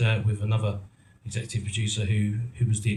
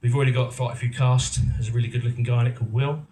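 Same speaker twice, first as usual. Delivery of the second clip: ordinary talk, nothing marked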